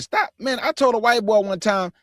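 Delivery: usual speaking voice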